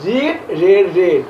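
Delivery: রিড with ee, রেড and রেড with short e; changed vowel. Shown as a demonstration. Teacher reciting verb forms, vowel changing from রিড to রেড. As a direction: drill রিড, then রেড twice like "red". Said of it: "কিন্তু উচ্চারণটা হবে".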